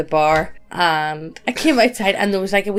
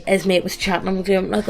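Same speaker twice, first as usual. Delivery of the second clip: normal talking voice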